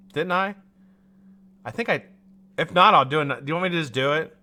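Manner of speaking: normal talking voice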